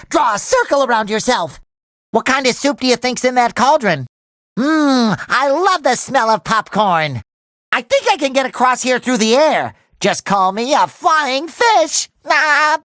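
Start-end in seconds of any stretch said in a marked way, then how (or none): none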